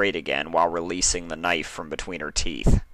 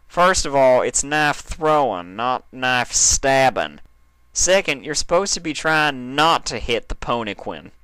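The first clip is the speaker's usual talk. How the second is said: Applejack lightly voiced, higher and firm; a stern friend setting the rules, a touch higher; 6 semitones higher, 4 dB louder than usual. A narrator's reading. Applejack correcting Dash's knife throwing, lightly voiced and higher.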